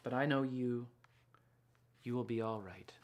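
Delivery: gentle read-aloud storytelling voice; picture book reading. A narrator's reading. Author reading her picture book to children, gentle storytelling.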